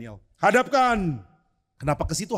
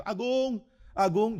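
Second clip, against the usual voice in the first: higher call of "Agung" across the house; calling out a name; 6 semitones higher, much slower than usual. Retold or imitated. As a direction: call your friend from outside. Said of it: "saya panggil".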